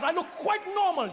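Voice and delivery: high, affronted British protest; indignant colonizer defending himself; offended British colonizer. Acted. Colonizer offended about his looks.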